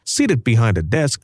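ordinary delivery, nothing marked

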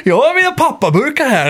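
high-pitched mock Norrland dialect; botched Norrland impression, pitched high; attempted Norrland accent. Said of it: "jag försöker".

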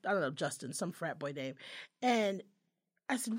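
ordinary talk, nothing marked

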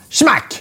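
sudden high-pitched smack; sharp comic impact; smack sound effect. Retold or imitated. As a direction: imitate a sting hitting the cheek. Imitating the sting hitting cheek.

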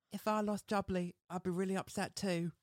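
high-pitched, exaggerated cockney lad; mock cockney accent. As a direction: imitate Robbie's cockney, amused. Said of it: "That accent is something".

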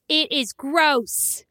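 put-on slow, drawn-out little boy's disgust; son's verdict. Mother imitating her four-year-old son, slow disgusted kid voice. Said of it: "He goes".

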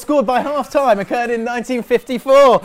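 loud, high trivia announcer voice; excited fact announcer. Acted. Delivers a niche football fact.

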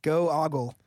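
"Go-Ogle" as go-AH-gul; "Go-Ogle" split in two, open ah second; deliberate mispronunciation. Shown as a demonstration. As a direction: say "Go-Ogle" with ogle starting on ah. Topping the others' Google mispronunciations.

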